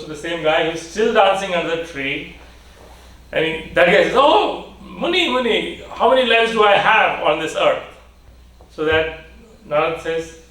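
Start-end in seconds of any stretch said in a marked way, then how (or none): 4.2-7.8 high, eager call to the sage. Acted. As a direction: call out to him, full of hope. Dancing man calls the sage.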